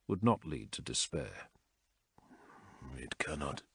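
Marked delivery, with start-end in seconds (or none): 3.0-3.6 hushed, much softer line; a low murmur into the dark; whispered. A narrator's reading. Zaul alone in the dark, whispering. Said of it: "he whispered to the darkness".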